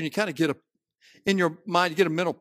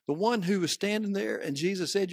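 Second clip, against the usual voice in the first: ordinary talk, nothing marked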